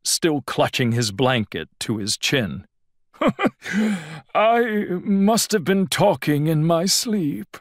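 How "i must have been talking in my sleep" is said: higher, nervous chuckling excuse; quavery chuckle. A narrator's reading. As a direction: laugh it off with a quaver. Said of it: "it was rather quavery".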